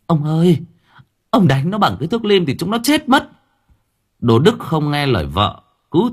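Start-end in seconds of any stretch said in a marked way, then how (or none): 0.1-3.3 high, fast, desperate begging; begging wife. Acted. Wife begging her husband to stop beating the boys, high and fast. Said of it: "chạy ra xin".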